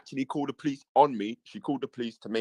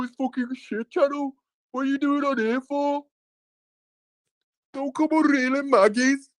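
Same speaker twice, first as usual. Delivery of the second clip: high-pitched mock Birmingham accent; 11 semitones higher than usual. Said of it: "I was doing a Birmingham accent".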